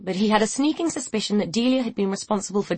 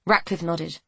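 usual speaking voice

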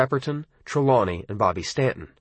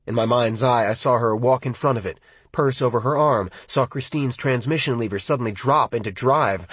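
usual speaking voice

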